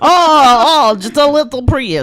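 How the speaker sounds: high, loud hammed-up Russian accent; annoying Russian character. Teasing John on the airport drive, loud Russian accent. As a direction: shout it in a silly Russian accent. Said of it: "I do an annoying Russian character".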